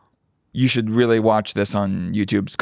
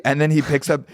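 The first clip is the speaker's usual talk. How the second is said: ordinary delivery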